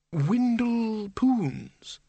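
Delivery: high, very drawn-out name; marvelling Windle. A narrator's reading. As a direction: savour the name slowly in a high voice. Windle says his own name, marvelling.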